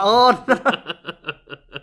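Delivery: loud, high mock groan with laughter; embarrassed wail. Acting his embarrassed reply, laughing.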